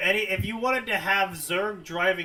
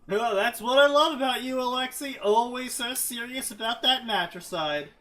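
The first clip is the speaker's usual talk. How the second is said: high, louder teasing villain; mocking villain; 8 semitones higher, 4 dB louder than usual. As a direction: toss it off with mock admiration. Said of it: "what Alexei would make".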